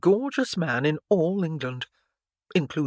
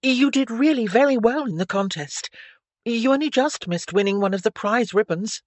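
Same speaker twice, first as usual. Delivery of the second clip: put-on higher, louder, emphatic girlish voice; encouraging Stephanie; 7 semitones higher, 5 dB louder than usual. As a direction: praise him eagerly in a raised pitch. Stephanie's voice in dialogue.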